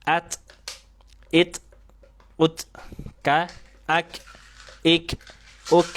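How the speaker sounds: at, it, ut, ka, ak, ik, uk diucap pendek dan jelas; sukun dipantulkan. Shown as a demonstration. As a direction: pantulkan huruf sukun satu per satu. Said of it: "Ketika sukun". (contoh huruf sukun yang tegas)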